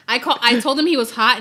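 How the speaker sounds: normal talking voice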